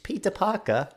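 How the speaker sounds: high British caricature; mock British accent. Co-host finishing the spelling joke, mock British accent. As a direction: say it high, dropping the r's like a Brit. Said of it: "in British accents".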